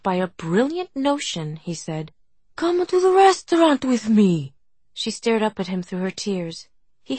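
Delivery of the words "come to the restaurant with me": eager. Acted. Struck by brilliant notion, urging.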